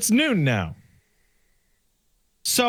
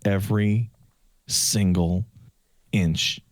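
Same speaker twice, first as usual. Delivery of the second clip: stunned disbelief; 9 semitones lower than usual. Retold or imitated. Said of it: "I'm like".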